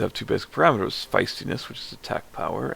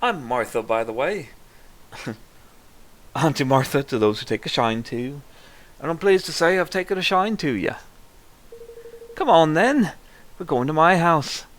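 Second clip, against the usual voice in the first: lilting auntie, swooping up in pitch; a wobbly attempt at a friendly village auntie; wandering auntie voice; 6 semitones higher, 3 dB louder than usual. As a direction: play a welcoming auntie, voice all over the place. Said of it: "that was all over the fucking place".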